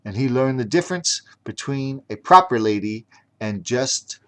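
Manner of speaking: plain reading voice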